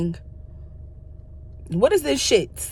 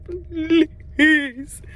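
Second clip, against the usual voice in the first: higher, whiny pleading "Please"; mock pleading please; 8 semitones higher than usual. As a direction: beg for it with a whine. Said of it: "I said".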